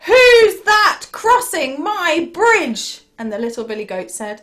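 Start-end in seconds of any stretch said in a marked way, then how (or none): 0.1-3.0 loud, high, slow troll challenge; challenging troll. Acted. Terrible troll to little goat on his bridge, loud challenge. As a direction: boom it out slowly like an indignant troll.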